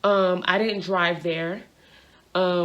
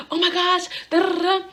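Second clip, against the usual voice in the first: high-pitched imitation of panic; anxiety attack; 10 semitones higher than usual. Mom hearing daughter needs bail money, imitated panic. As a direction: mimic a flustered mother, high. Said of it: "she's having an anxiety attack".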